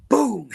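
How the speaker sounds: high sharp boom; mock bang. Retelling Owen banging the car ceiling, sharp imitated boom. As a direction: punch out the sound of the hit.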